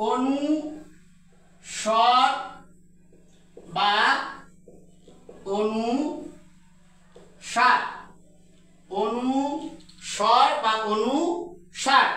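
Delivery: অনুস্বর drawn out very slowly, each sound clear; slow careful demo. Shown as a demonstration. Teacher models অনুস্বর for students, very slow and careful. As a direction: spell out অনুস্বর syllable by syllable, very slow. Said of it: "উচ্চারণ করতে হবে".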